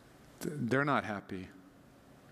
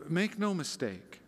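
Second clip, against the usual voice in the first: ordinary talk, nothing marked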